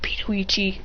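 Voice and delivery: hissed whispered name; hushing him under her breath. Acted. She hushes him angrily.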